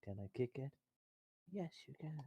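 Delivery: hushed whisper. Real, not acted. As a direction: whisper it under your breath. Whispered aside, unvoiced.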